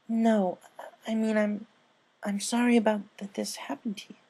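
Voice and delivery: high, flustered apology; correcting herself in a rush; high filly voice, sorry and flustered. Acted. Scootaloo voiced in story.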